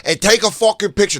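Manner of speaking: hammed-up high, loud holler; demanding fan yell. Fans demand a picture from Sal, imitated yelling. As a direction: bellow it like a pushy fan.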